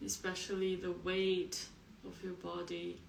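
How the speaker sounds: soft meditation voice with long pauses; guided meditation. Acted. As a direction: guide them quietly into the body, leaving space between phrases.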